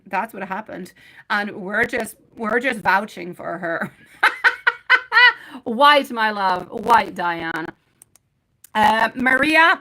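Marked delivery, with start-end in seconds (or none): 4.2-5.6 high loud burst of laughter. Real, not acted. Reader laughing with chat about vouching, loud high laugh. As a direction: break into a big high-pitched laugh.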